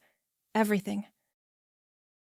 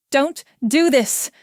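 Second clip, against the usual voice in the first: urgent, louder, slightly raised plea; pleading; 4 semitones higher, 7 dB louder than usual. A narrator's reading. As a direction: plead with some force. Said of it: "she pleads".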